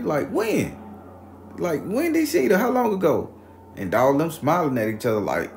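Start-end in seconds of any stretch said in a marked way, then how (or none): 0.3-3.3 high, loud disbelieving questions; imitated incredulous neighbor. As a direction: grill him in mock disbelief. Laughing neighbor questions him incredulously.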